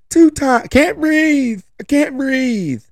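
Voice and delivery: put-on high, whining kid voice, slowing at the end; whiny kid complaint. Son fussing in the car seat to dad, high whiny kid voice. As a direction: whine like a boy claiming he can't breathe.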